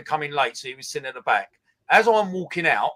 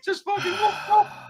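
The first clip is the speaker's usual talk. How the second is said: laughing. Real, not acted. Laughing at his own prank.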